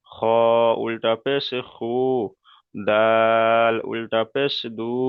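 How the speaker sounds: final "উ, বু, তু" each held long; drawn-out letter drill. Shown as a demonstration. Teacher showing ulta pesh to pupils, long "উ". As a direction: spell it slowly, stretching "জিম", "দাল" and the closing "দু". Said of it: "এক আলিফ পরিমাণ করে টান দিয়ে পড়তে হবে".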